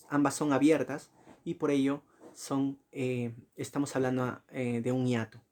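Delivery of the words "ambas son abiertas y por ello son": normal talking voice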